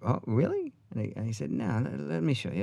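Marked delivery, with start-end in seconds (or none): 0.0-0.7 high, rising, mock wonder; retold innocent surprise, pitched well up; surprised reply. Acting own surprised reply to Sam. 1.5-2.6 lightly voiced Australian accent; soft, higher mock Australian offer to help; hint of a bad Australian accent; Sam Neill. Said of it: "This is a horrible Australian accent".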